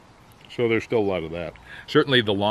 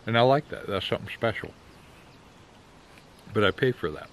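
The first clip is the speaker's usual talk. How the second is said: speaking through clenched teeth; talking around a pipe. Real, not acted. Seen 1: Pipe stem held between teeth while he talks throughout.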